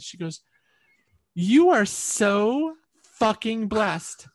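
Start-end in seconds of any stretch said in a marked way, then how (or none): 1.3-4.3 slow, loud and drawn out, higher on SO; emphatic admiring quote. Retold or imitated. Podcast host to storyteller after hearing the story, emphatic and slow. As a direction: stretch each word with emphatic weight. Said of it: "I loved the way she said this".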